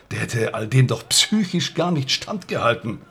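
ordinary talk, nothing marked

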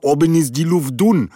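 put-on a light Eastern European boxer accent, R rolled; boxing brothers accent. Voice actor quotes boxing brothers in mock Eastern European accent. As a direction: imitate the boxing brothers' East European German. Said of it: "die sagen immer".

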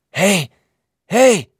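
loud high call in two bursts; calling into the hole. A narrator's reading. Thilo calls into the hole hoping for an echo, loud shouting. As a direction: shout it into the dark opening. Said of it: "rief Thilo hinein".

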